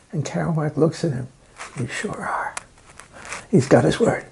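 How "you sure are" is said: dry whispered reply; hushed deadpan aside. Retold or imitated. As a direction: drop to a whisper for the comeback. Kerouac's dry reply, retold.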